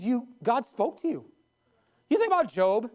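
ordinary delivery

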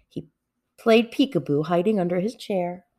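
ordinary delivery

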